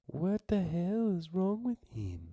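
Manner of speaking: high, slow dramatic exasperation; exaggerated exasperated voice. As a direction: overplay the shocked question. Acting a line, high slow.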